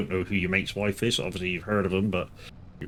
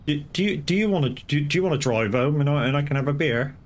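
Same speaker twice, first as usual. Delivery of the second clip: wheedling higher voice; wife asking to drink instead, mock sweet and higher; imitating the wife's switch; 6 semitones higher than usual. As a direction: ask sweetly to swap roles. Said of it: "it's like".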